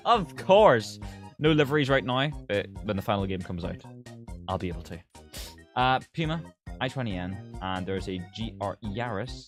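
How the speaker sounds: ordinary talk, nothing marked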